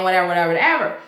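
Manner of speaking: normal talking voice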